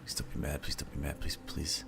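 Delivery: soft, pleading apology; walking on eggshells. Real, not acted. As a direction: beg quietly not to anger her. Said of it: "She's really mad at me".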